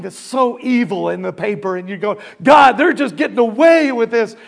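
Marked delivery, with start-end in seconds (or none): 2.4-4.4 loud, higher-pitched protest to God; acted complaining. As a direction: complain aloud, indignant on someone's behalf. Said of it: "I would complain".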